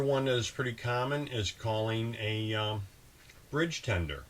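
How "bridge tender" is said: normal talking voice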